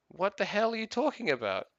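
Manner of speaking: high-pitched retold bafflement; baffled exasperation. Retold quarrel with his wife.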